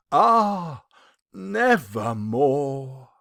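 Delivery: ordinary delivery, nothing marked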